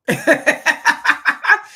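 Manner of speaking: loud rising laugh; amused laugh. Real, not acted. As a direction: laugh at yourself. Laughing at failing challenge.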